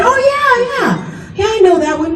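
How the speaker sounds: high, eager mock "yeah yeah" covering panic; fake confident yes. Telling [name] she knows the song, in a high overeager bluff. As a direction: bluff it brightly, too eager.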